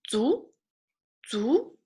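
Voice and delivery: "zu" rising steeply to a high end; second tone. Shown as a demonstration. Teacher modelling another syllable for learners, rising second tone. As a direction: swing "zu" upward, like asking. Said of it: "a second tone too".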